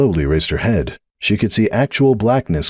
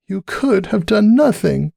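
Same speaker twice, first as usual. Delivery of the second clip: slow, high, mildly weak voice; a slow, feeble reproach; weakly; 13 semitones higher, slower than usual. A narrator's reading. Cupcake reproaching Sunburst, slow and weak. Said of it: "Cupcake said weakly".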